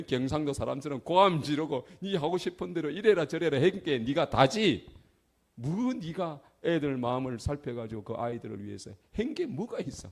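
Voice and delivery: loud rebuking inner voice, building; a conscience berating a father, loud and rising; inner scolding. Retold or imitated. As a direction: scold yourself hard, rising to a shout.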